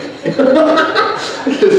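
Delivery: high, loud burst of laughter; real laugh. Real, not acted. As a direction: crack up mid-story. Cracks up mid-story.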